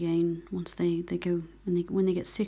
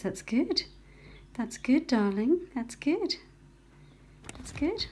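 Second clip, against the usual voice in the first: bright sing-song encouragement, climbing higher; rising delighted praise; 7 semitones higher than usual. Real, not acted. Rescuer cheers the reviving bird, higher rising praise. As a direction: let each "that's good" lift with joy.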